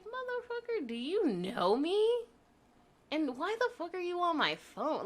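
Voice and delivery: high, outraged mock telling-off; furious retold outburst. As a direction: relive the anger at the ex's call. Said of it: "I was like".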